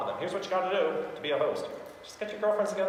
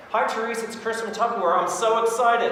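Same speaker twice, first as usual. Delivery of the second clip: higher, louder, enthusiastic phone opener; excited greeting; 8 semitones higher, 6 dB louder than usual. Shown as a demonstration. Tupperware seller opens a demo call to a client, excited. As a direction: open the call full of excitement. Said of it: "Be excited".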